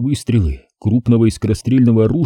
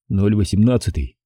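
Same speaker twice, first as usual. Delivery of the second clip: plain reading voice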